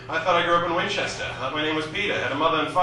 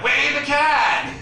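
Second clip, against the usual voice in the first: loud, slow, higher Boston yell of wait for the cab; Boston accent shout; 12 semitones higher, 5 dB louder, much slower than usual. Retold or imitated. A big Boston woman outside Fenway yells in a thick Boston accent. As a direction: bellow it in thick Boston English, slowly. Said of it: "Boston English".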